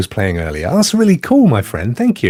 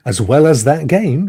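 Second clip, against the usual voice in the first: usual speaking voice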